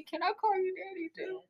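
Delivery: flirty sweet ask. Retold or imitated. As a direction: bat your lashes and ask it sweetly. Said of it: "I be".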